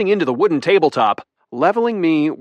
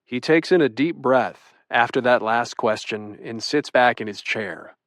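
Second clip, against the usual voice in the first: usual speaking voice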